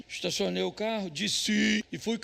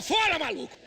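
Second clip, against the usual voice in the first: imitating a fleeing husband, higher and louder; panicked husband yell; 7 semitones higher, 4 dB louder than usual. Fleeing husband abandoning his wife, mock yell. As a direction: shout it while running off. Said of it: "o marido rapou".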